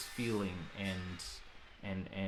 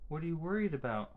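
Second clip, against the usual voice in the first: put-on higher, gentle asking of a child; coaxing high question; 8 semitones higher, 3 dB louder, faster than usual. Mother asking her son what worries him, higher coaxing voice.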